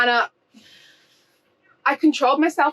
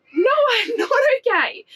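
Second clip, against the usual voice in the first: laughing through tears; 12 semitones higher than usual. Retold or imitated. Tearful retort to the school lady.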